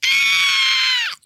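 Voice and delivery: loud, very high scream; frustrated scream. Acted. Woman reacts to her mangled surname, frustrated scream. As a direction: let out a piercing mock-exasperated "ah". Exasperated at the mispronounced name.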